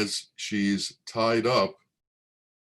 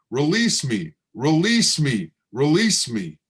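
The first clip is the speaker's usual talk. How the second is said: loud pleading scream, higher; 5 semitones higher, 7 dB louder than usual. Acted. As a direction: cry out to be set free. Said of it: "And she starts screaming".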